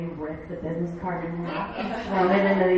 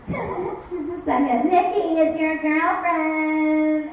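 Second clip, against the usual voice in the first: loud, slow, sing-song taunt, higher; mocking older sister; 7 semitones higher, 8 dB louder, much slower than usual. Older sister taunting her brother about his girlfriend, loud and sing-song. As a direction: sing it out to tease him. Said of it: "this is pretty much how it went".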